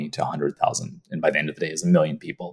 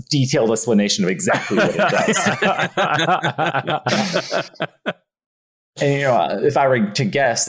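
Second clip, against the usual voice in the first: giggling his way through the mock email; can't keep a straight face reading it out; laughing through it; 5 semitones higher than usual. Retelling his sneaky anonymous email to MySpace, laughing.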